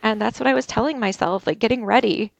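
ordinary talk, nothing marked

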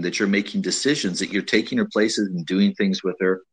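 normal talking voice

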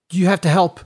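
loud, high, urgent begging; desperate plea. Acted. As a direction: beg for help, voice straining. Frantic plea from accused brother.

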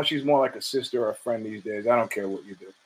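low casual shrug. Retold or imitated. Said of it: "He goes".

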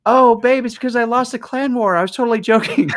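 high, pleading backpedal into laughter; hammed-up high apology; mock panicked apology. Retold panicked apology to wife.

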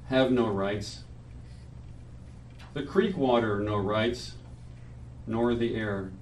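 measured poetry reading at a memorial; solemn reading. Acted. Reads his friend's poem aloud.

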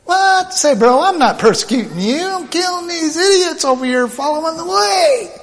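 high-pitched, incredulous protest; Paul. Acted. Paul to the voice, defending his killing, high indignant protest. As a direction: argue back in a high, hammed-up whine of disbelief. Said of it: "would think that Paul would have came back with".

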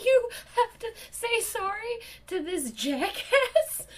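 high, loud, incredulous protest; indignant outrage. Retold or imitated. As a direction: exclaim it in high-pitched disbelief, drawn out. Outraged on the clerk's behalf.

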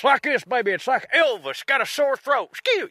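put-on high Southern accent, like [name] with a sore throat; Buttercream Dreams. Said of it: "the Buttercream Dreams accent, which is Southern".

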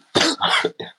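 short dry cough of a sick man. Real, not acted. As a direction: cough once, unvoiced. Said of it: "je tousse, je suis un peu malade". (toux de malade)

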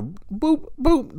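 high mock beeping noises; scanner beep. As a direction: imitate a checkout scanner.

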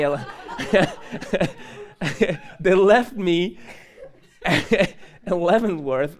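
can barely get it out for laughing; giggling disbelief at being forgotten. Real, not acted. Laughing while telling being left.

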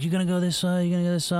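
high, eager pushy calling out. Retold or imitated. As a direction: call him out to the big wave, egging him on. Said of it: "a lot of guys, you know, come like".

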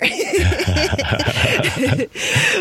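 bright burst of laughter over the joke; real laugh. Real, not acted. Laughing at own baggage joke.

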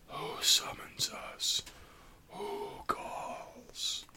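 breathy gurgling spirit from the shadows; bubbling whisper. Acted. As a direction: whisper it like something bubbling up from darkness. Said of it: "a bubbling, whispering voice".

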